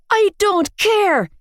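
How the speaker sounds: high, slow screamed refusal; screamed back. A narrator's reading. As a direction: scream it defiantly. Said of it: "I screamed back".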